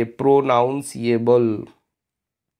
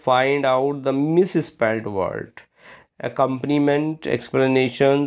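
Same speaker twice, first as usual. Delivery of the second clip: slow question read; much slower than usual. Shown as a demonstration. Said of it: "reads exam question".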